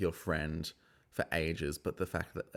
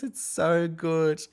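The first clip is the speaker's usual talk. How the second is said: ordinary delivery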